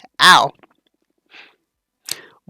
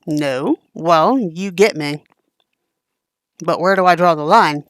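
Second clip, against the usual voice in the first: ordinary talk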